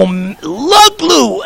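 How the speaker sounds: high, drawn-out mock craving; imitated eager crowd. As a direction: imitate people drooling over a treat, stretched slow. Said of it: "the people would go".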